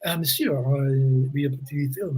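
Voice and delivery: softly voiced Frenchman's accent; French gentleman. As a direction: put on a halting French accent. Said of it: "this French gentleman".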